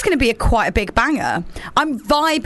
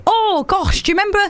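excited nostalgia; 10 semitones higher, faster than usual. Retold or imitated. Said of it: "when we say".

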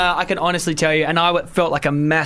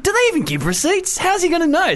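panicked thought. Retold or imitated. Panicked inner voice.

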